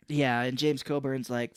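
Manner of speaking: usual speaking voice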